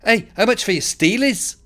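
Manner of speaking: put-on high, cheeky stab at a Scouse accent; attempted Liverpool accent. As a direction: imitate a Scouse kid, pitched high. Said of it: "I'm gonna try the accent".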